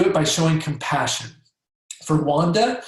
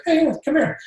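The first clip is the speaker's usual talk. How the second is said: breezy, much higher, no big deal; minimizing tone; 11 semitones higher than usual. Shown as a demonstration. Doctor inviting sulky teen Paul over, lightly offhand. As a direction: call him over like it's nothing. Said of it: "minimizing tone, like, it's not a big deal".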